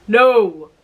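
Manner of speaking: firm no. A narrator's reading. Father refuses the little boy, loud and firm. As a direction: cut him off with a firm no.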